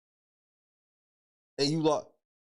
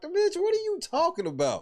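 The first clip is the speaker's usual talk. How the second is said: exasperated disbelief; 10 semitones higher than usual. Real, not acted. Exasperated after mimicking the excuse.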